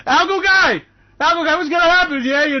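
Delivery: high, loud, fast excited call. Acted. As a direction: shout across the room to Algo Guy. Calling out to Algo Guy.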